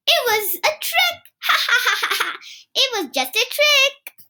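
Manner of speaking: put-on high, giggling little boy; laughing. Kipper laughing at Dad over his trick. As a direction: crack up with glee over the prank.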